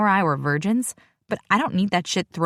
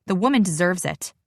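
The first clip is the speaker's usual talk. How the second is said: plain reading voice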